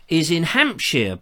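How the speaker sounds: ordinary talk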